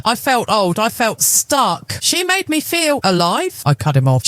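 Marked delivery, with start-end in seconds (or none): 2.0-3.6 pleading husband. A narrator's reading.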